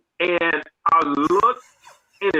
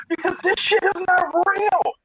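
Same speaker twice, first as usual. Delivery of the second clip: very high, strained babble of disbelief; disbelieving rant; 14 semitones higher than usual. Retold or imitated. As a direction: spill it out like you're in shock. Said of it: "And I was like".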